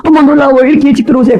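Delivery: high-pitched wifely wonder; acted delighted surprise; amazed. Shown as a demonstration. Wife greeting husband home early, gushing amazement. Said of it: "근데 놀래주세요".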